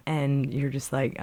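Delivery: ordinary delivery, nothing marked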